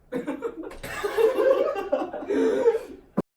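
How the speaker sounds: loud high laughing fit. Real, not acted. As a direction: burst out laughing. Laughter after calm down.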